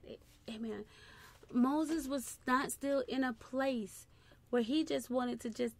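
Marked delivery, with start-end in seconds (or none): 0.1-0.8 breathy whispered aside; whispered amen. Real, not acted. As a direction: whisper it under your breath.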